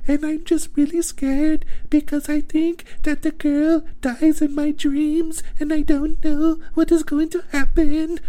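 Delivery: mock her as a little baby doll. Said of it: "she talks like a little baby doll".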